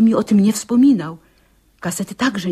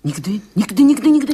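rising alarmed refusal; 3 semitones higher, 5 dB louder, faster than usual. Acted. Aunt refuses to call the police.

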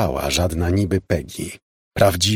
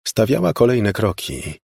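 usual speaking voice

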